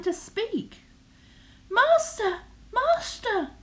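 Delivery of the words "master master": high, loud shrill calls; harp shouting. Acted. Magic harp calling its sleeping master, high and loud. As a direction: cry out for your master in a high voice. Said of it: "it shouted".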